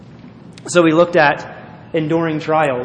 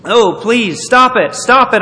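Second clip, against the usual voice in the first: high, loud, pleading cry; 7 semitones higher, 6 dB louder than usual. Acted. Painted teacup begging the master to stop, crying out. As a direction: plead loudly, pitch leaping up. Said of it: "I cried".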